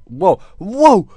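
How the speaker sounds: high, loud startled cry; startled whoa. Real, not acted. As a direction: yelp in amazement. Startled by backflip.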